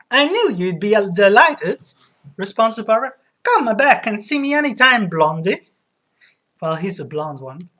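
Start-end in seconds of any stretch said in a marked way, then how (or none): 0.1-1.8 loud Italian barber; Italian accent. Acted. Barber finishing the hair treatment, Italian accent. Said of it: "Italian accent". 3.4-5.7 high, loud Italian barber; Italian accent. Acted. Barber welcoming a customer, Italian accent. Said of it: "kinda made him sound like Mario".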